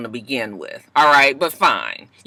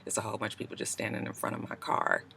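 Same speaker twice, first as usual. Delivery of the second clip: hushed aside; 7 semitones lower, 18 dB quieter, faster than usual. Real, not acted. People blocking her car.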